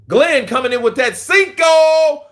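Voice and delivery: slow, high hype shout at a viewer's donation. Real, not acted. Streamer hyping a donation from Glenn: slow shout. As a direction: stretch it out and shout the shout-out.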